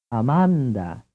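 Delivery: Amanda with the second "ah" stronger; ah-MAHN-dah, weight on MAHN; Italian stress. Shown as a demonstration. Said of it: "Which vowel in the word sounds a little louder".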